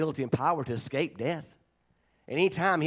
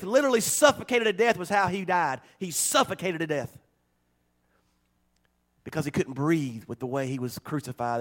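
normal talking voice

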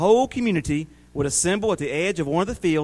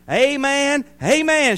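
high, rousing double amen; pitched-up, eager crowd shout; 9 semitones higher, 3 dB louder, slower than usual. A narrator's reading. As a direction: cheer it like a fired-up congregation. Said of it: "shouted the crowd".

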